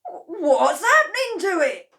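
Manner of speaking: high, loud alarm; alarmed cry. A narrator's reading. Mister Cranky watching the chicken, loud alarm. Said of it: "cried Mister Killy Cranky".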